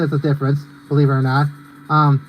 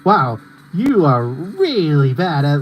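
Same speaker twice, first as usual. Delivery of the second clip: mock put-down, slowly stretched out; Pinkie Pie; 2 semitones lower, much slower than usual. Quoting a video when the goal is missed, drawn-out mocking imitation. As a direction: lean long and swooping on "really". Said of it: "It's like, when Pinkie Pie says something".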